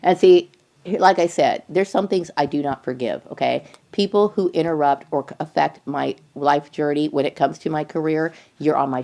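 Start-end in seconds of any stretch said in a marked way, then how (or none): none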